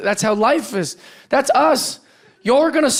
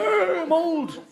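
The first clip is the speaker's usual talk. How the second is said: put-on high, feeble old-timer groan; imitated old person; 11 semitones higher, 4 dB quieter, slower than usual. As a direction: whimper an excuse as a startled old person. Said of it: "someday I'll be old".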